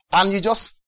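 ordinary delivery

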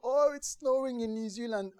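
put-on high, bright-eyed child's exclamation; thrilled child. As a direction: gush it out as an excited ten-year-old. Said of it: "being a ten-year-old".

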